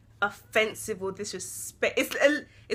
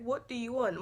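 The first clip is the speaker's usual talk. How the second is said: much softer, even, plain "What do you want?"; neutral English; 10 dB quieter than usual. Shown as a demonstration. Daughter shows mum the gentle English version, quiet. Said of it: "it's completely different".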